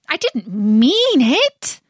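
slow, high, pleading voice. A narrator's reading. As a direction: beg him, hurt and sorry. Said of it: "pleaded poor Alice".

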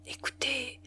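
soft breathy whisper in her ear. A narrator's reading. Suitor whispering into her ear, hushed. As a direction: lean in and whisper it. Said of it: "Il lui parlait tout bas".

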